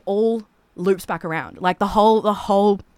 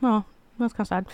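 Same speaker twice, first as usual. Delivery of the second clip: sad sigh; 2 semitones higher than usual. Retold or imitated. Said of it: "That's, that's the sound of that one".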